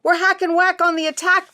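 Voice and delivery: fast, high, loud battle cry; bellowed. Acted. Twins charging toward the screams, loud battle cry. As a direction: roar it as a charge. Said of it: "they bellowed".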